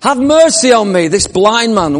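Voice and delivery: shouting. Acted. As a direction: shout over the crowd for mercy. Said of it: "he shouted all the more".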